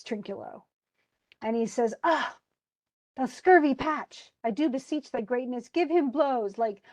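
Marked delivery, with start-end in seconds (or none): 2.0-6.6 higher, acted indignant railing; mock outraged complaint; exasperated outburst. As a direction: rail at the culprit, drawing out the insult. Caliban rails at Trinculo.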